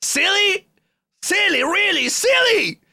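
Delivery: very high, outraged screech; mock screaming. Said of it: "I've been screaming too much".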